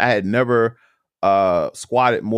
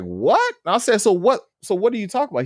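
baffled; 9 semitones higher than usual. Retold or imitated. Confused by the remark about benching.

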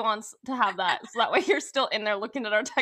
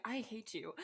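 quiet; 3 semitones lower, 15 dB quieter than usual. Retold or imitated. Staring at him at 1:30am.

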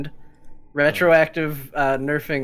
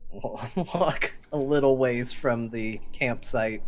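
usual speaking voice

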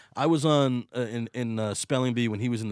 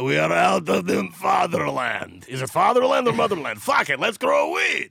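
slow, loud hammed-up Russian accent shouting; mock Russian; 7 semitones higher, 5 dB louder than usual. Russian cosmonauts declaring freedom in space, mock Russian shout. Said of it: "They're like".

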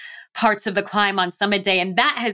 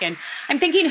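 usual speaking voice

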